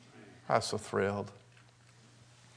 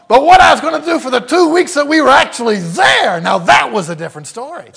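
high, loud preacher's build-up; loud, high pulpit preaching; preaching at full force; 9 semitones higher, 13 dB louder than usual. Acted. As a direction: drive it home to the congregation, loud.

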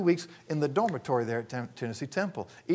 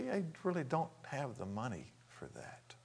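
whispered; 14 dB quieter than usual. Retold or imitated. Embarrassed plea about money.

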